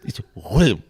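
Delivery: "William" swallowed into one syllable, "Wim"; posh "William". Shown as a demonstration. Posh man too busy for letters, swallowed "William". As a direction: mumble "William" down to a posh "Wilm". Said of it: "if you see a really posh William".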